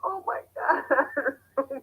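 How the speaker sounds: gasping it out mid laugh. Real, not acted. Breathless laughter at her own story. Laughing after embarrassing confession.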